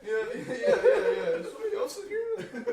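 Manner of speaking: very high, excited; squealing high. Real, not acted. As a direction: yelp it out.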